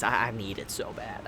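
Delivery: low, soft pleading murmur; quiet longing. Real, not acted. Fan who can't wait for the translation, quiet longing. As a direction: murmur it with deep yearning.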